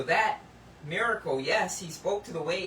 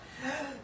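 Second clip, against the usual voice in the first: mock gasp; 7 semitones higher, 5 dB quieter than usual. Gardener finds flower gone.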